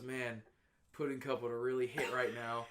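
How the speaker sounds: low, soft mock of the friend. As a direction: mumble it like a smug buddy. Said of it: "fucking comes up to me and goes".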